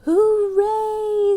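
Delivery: very high, loud hooray; cheering. A narrator's reading. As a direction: cheer with full joy. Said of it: "said the mayor".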